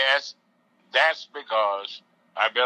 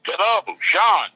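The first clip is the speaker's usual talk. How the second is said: phone speaker; 9 semitones higher, 5 dB louder, much slower than usual. Real, not acted. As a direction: voice over a telephone line. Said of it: "I have you on speakerphone".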